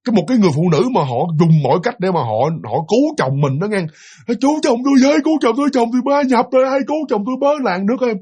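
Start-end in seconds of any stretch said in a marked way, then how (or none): 4.4-8.1 high, pleading wife crying for help; desperate cry for help. Retold or imitated. Wife chasing her possessed husband, calling out desperately. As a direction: beg the village to save her husband. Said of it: "Vừa chạy theo mà vừa khóc".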